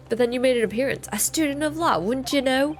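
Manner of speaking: McGilded. Acted. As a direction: lilt upward in an uncertain put-on accent. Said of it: "I don't know what accent he has now".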